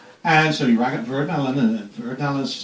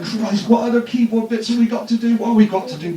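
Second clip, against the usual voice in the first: retold fluster; 7 semitones higher than usual. Retold rush to finish keyboard parts.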